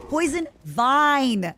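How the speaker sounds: "Poison vine" slowly, a firm v in "vine"; careful pronunciation. Shown as a demonstration. Correcting her accent while explaining a game item, slow and careful. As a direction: sound out "vine" with a clear v, slowly. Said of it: "I struggle with my W's".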